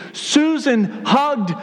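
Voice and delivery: excited exclamation. Retold or imitated. Church members telling the preacher about a hug, excited exclamation. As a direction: exclaim it word by word, pitch jumping. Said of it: "excitedly approached me".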